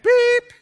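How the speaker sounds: high, loud electronic beep; imitated beep. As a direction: squeal it like a phone alarm. Phone alarm sound imitated.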